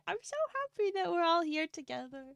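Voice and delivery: normal talking voice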